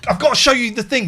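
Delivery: high, loud rush of eagerness; excited. Real, not acted. Host eager to show co-host the AI website. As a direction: blurt it out, bursting to share.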